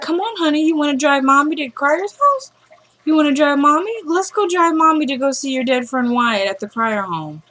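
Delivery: put-on high, soft baby-talk coaxing; mock coddling mother. Mother asks son to drive mommy, high mocking baby talk. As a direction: coax him like a little child.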